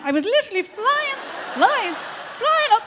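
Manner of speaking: soar up in pitch like you're flying; giddy high. Acted. Comic recalling the hormone high, giddy and high-pitched.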